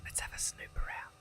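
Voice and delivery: hushed sneaking whisper. Acted. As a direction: breathe the words, sneaking around. Sneaking through a dark house.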